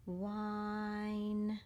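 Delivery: "Whine" stretched out with a long held I; decoding demo. Shown as a demonstration. Teacher saying watch me, "Whine" drawn out smoothly. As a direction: sound out "Whine" slowly on a level tone. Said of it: "going to read it like this".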